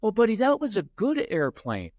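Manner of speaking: high, sing-song condescending praise; condescending parent. Retold or imitated. Parent soothing the upset kid with high, sing-song condescension. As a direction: talk down sweetly to a child. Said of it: "that's condescending".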